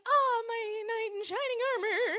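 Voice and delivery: high, dreamy damsel voice; swooning damsel. Retold or imitated. Caught by her crush, a hammed-up swooning damsel. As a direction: swoon over your rescuer.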